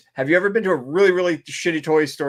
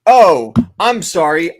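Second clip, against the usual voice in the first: mock clerk; 2 semitones higher, 9 dB louder, slower than usual. Imitating price-changing clerk.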